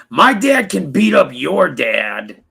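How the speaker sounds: slow, mocking kid boast; a hint of a bragging child, drawn out; sneering playground brag; mock schoolyard taunt. Mocking childish grown men.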